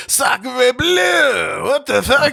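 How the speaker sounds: loud, high outraged French voice; hammed-up indignant Frenchman shouting; mock French protester. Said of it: "And they're like".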